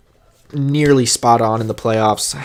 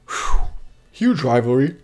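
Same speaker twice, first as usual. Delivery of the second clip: yawning. Real, not acted. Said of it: "I don't know why I'm yawning".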